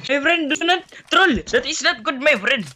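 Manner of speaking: high, sing-song mock Indian accent; playful Indian accent impression. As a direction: joke Indian accent, pitched well up. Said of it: "Indian accent".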